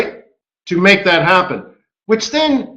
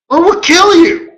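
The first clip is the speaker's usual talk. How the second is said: mock threat; 10 semitones higher, 5 dB louder than usual. Government threatening banks.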